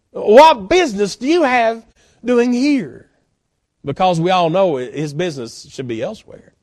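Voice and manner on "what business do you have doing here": louder, higher-pitched demand to explain; challenging question. Acted. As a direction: confront him as God calling him out. Voices God challenging Elijah.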